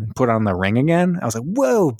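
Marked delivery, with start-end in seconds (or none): 1.4-1.9 high, drawn-out whoa; mock amazed whoa. Podcaster retelling his reaction to faked death, mock amazed. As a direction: stretch it out in mock wonder.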